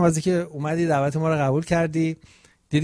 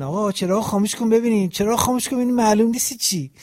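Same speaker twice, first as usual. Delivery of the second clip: higher, louder mock viewer grumbling; imitating complaining viewers; 6 semitones higher, 4 dB louder than usual. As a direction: play an annoyed viewer squinting at the screen. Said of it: "مردم اینجوری نگردن".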